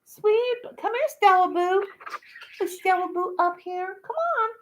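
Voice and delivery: high, sweet coaxing coo. Real, not acted. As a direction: coax the little bird over lovingly. Cooing to her bird.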